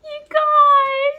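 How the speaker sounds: high, tearful, crying voice; emotional crying. Real, not acted. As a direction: cry out, overwhelmed and moved. Moved to tears by book.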